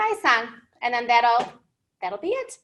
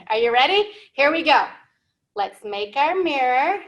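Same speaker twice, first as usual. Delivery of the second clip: usual speaking voice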